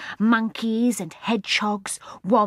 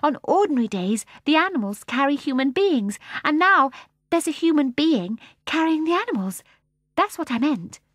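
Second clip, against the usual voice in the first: apologetic explaining; 8 semitones higher, 4 dB louder than usual. A narrator's reading. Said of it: "hurrying after him to apologize".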